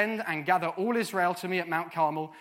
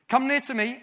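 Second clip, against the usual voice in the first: loud, raised-pitch summons; calling people over; 7 semitones higher, 7 dB louder than usual. Acted. Elijah to the people of God, loud summoning call. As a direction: call out to a gathered crowd. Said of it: "I happen to be Elijah".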